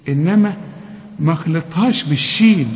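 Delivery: normal talking voice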